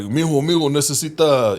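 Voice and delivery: calling "mijo" like the Mexican crew; imitating Spanish-speaking coworkers. Coworkers calling him over on the build site, mock Mexican Spanish accent. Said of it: "So they would be like".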